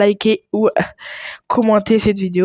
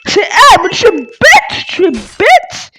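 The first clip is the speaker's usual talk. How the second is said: high, loud and drawn-out complaint; loud, high-pitched gamer exasperation; exasperated yelling; 9 semitones higher, 6 dB louder, slower than usual. Real, not acted. Exasperated outburst at other players in game.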